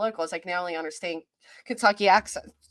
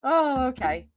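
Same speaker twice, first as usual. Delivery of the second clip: usual speaking voice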